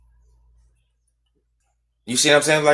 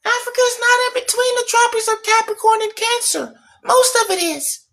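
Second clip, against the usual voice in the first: high nasal-free mock nerd voice, much higher; prissy know-it-all impression, pitched way up; mocking technical people. Mocking the technical people who correct him, high mock voice. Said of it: "Let me take that back 'cause we got a lot of technical people".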